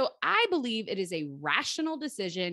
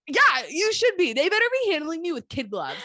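mock threat; 7 semitones higher, 7 dB louder than usual. Menacing reply to mock fear.

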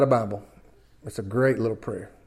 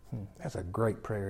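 ordinary delivery

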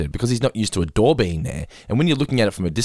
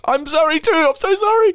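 very high, mock-tearful apology; hammed-up remorse, pitch shot right up; over-the-top apology; 4 dB louder than usual. Owner answering the dog's sorry look, gushingly apologetic.

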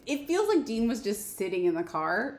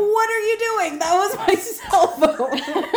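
high, raised-voice protest; mock outrage; 9 semitones higher, 4 dB louder, slower than usual. Sam to Dean over his thrown phone, outraged. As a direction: act appalled, half laughing.

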